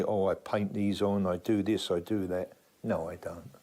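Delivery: usual speaking voice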